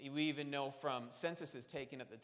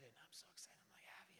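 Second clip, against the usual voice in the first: excited whisper; 22 dB quieter, faster than usual. Retold or imitated.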